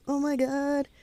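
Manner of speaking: high, mock-horrified gasp of words; retold dismay. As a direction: imitate a kid in shock. Kid stunned after wrecking the car.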